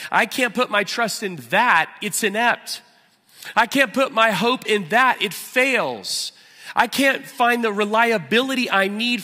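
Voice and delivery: loud, high, driving sermon voice; impassioned pulpit preaching; full-force preaching. Acted. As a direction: preach it out with fire to the room.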